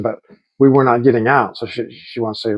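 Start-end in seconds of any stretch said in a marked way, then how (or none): none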